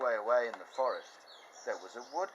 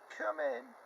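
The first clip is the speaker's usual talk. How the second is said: put-on much higher, old granny voice; Grandma's voice. Grandma answering the door, high old voice. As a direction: quaver like a frail grandma. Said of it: "And Grandma said".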